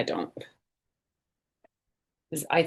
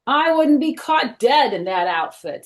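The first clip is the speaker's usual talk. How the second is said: higher, louder bratty scoff; scornful refusal; 8 semitones higher, 5 dB louder than usual. Acted. D W scorning the turkey costume, loud and high. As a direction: scoff like a bratty little sister.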